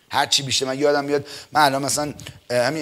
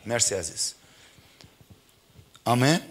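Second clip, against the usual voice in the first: ordinary delivery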